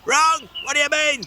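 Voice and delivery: very high sharp gnome retort; snapping. Acted. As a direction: bite back in disbelief. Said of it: "snapped Gordon".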